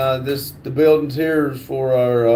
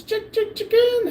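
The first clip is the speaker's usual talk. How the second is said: very high falsetto clucking, softer; playful chicken squeak; 4 dB quieter than usual. Pretend. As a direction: squeak it out like a little hen. Playful clucking after chicken joke.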